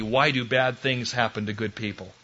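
normal talking voice

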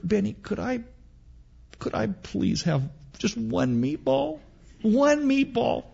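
put-on high, soft, begging child voice; pleading little girl. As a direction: wheedle for just one meatball, up high. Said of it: "and Mary".

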